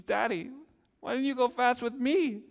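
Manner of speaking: put-on high, tearful little girl's plea; daughter's wounded question, high and teary; imitating a crying child. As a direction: mimic a hurt child asking through tears. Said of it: "and she's crying".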